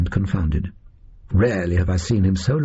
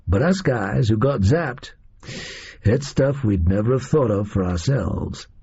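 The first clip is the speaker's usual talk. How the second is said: folksy American gunman explaining himself; American captor. Acted. Oswald explains the freeing device to Holmes, American captor voice. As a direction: lecture the gentleman in a casual American accent.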